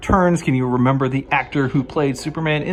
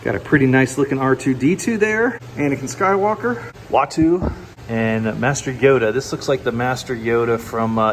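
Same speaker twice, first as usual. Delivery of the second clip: ordinary delivery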